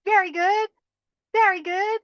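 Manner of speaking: high, loud very good rising, then a yay; excited praise and cheer. Acted. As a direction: celebrate wholeheartedly. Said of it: "congratulate yourself".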